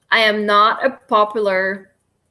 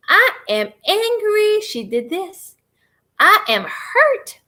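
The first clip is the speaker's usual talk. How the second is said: slow, higher, each word stretched out; honest admission; 7 semitones higher, slower than usual. Shown as a demonstration. Admitting hurt while tapping, slow and higher. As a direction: own it slowly, word by word. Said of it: "the truth is".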